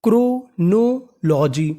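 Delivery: Chronology very slow, split into parts; drawn-out teaching pronunciation of chronology; syllable by syllable. Shown as a demonstration. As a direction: sound out chronology for a class. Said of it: "तो meaning बनी".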